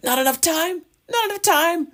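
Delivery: very high, panicked squeaky cry; scurrying goblin panicking about time; frantic goblin squeak. Acted. Goblin to the party, high panicked squeal. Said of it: "he goes".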